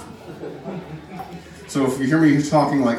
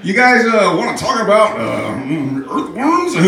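high, halting stoner voice with a loud chuckle; comic celebrity impression, raised pitch and chortling; Seth Rogen; 8 semitones higher, 4 dB louder than usual. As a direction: hem and haw, then break into a big goofy laugh. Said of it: "Seth Rogen showing up".

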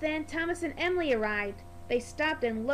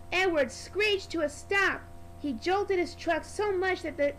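plain reading voice

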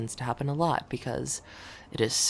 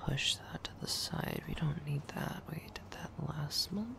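whispering; 3 semitones higher, 11 dB quieter than usual. Real, not acted. Quietly working at her desk.

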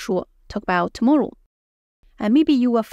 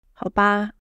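resigned, a little let down; disappointed. Shown as a demonstration. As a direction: accept it reluctantly. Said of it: "It's a little bit disappointed".

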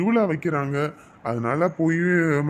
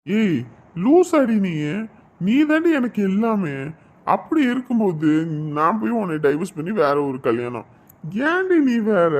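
exasperated loving shout; 3 semitones higher than usual. Acted. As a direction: shout your devotion at her disbelief. Husband protests to crying wife.

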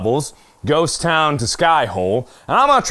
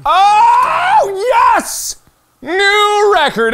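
high, drawn-out victory yell; elated high shout; triumphant cheer; 14 semitones higher, 4 dB louder, much slower than usual. Real, not acted. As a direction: celebrate loudly, stretching the words. Celebrates a new record.

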